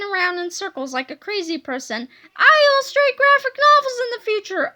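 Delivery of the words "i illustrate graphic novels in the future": high, loud, crazed glee; overjoyed scream. A narrator's reading. Boy learns his future career, screaming with glee. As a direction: shout it out as if jumping about. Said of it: "screamed Harold".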